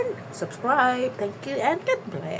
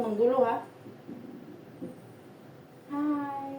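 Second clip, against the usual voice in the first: usual speaking voice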